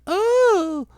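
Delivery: squeaky, loud hammed-up "Oh!"; very high mock gasp of pleasure. Host voicing the lady mid-sex, squealing mock "Oh!". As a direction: falsetto pleasure cry, played for laughs. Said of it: "She'd be like".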